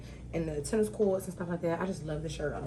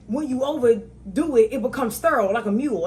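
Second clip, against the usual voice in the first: lightly voiced explaining father, louder and higher; imitating father explaining; 6 semitones higher, 9 dB louder than usual. Father explaining seedless watermelons, loud mock lecturing.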